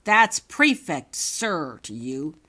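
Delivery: slow, loud angry correction. Acted. Prefect scolding Holly for using his name, angry and loud. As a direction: bite off each word at her. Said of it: "he said to her angrily".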